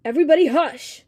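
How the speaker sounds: loud, sharp hush-up order; scolding. A narrator's reading. Cleo silences a car full of passengers, loud scolding. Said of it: "Cleo scolded".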